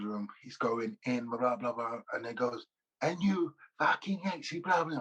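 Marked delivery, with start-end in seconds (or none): 3.0-5.0 a higher, badly faked Scottish manager's accent; half-hearted Scottish manager ranting; attempted Scottish accent. Mocking the Scottish gaffer, a poor Scottish accent. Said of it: "I can't do a Scottish accent".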